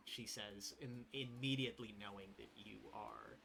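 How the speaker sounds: usual speaking voice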